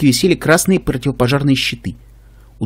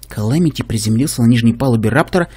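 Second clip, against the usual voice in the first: plain reading voice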